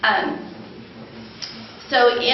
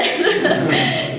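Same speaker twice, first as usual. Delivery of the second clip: short laugh; 9 semitones higher, 4 dB louder than usual. Real, not acted. Laughs after relieved thought.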